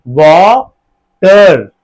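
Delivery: वाटर in two long beats, वा-टर; joined final r. Shown as a demonstration. Teacher correcting the split, वाटर in two slow syllables. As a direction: stretch वाटर slowly, र joined onto ट. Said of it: "र अलग से नहीं आता".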